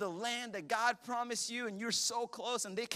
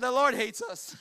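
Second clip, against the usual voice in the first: loud, higher whiny complaint; mock lament; 6 semitones higher, 7 dB louder than usual. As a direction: complain loudly to God. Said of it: "They said".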